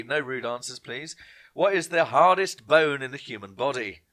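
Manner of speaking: normal talking voice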